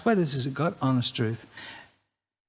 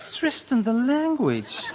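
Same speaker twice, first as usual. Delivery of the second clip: higher, slow prissy scolding; mock prim woman; 8 semitones higher, slower than usual. Comic imitating partner scolding his language, prim higher voice. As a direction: tut like a prim lady. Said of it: "And she says".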